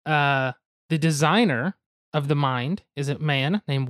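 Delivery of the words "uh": ordinary talk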